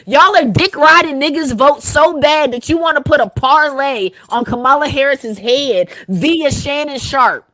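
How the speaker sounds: shouting in disbelief at the choice; angry rant. Real, not acted. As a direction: go off, loud and fed up. Continued furious rant.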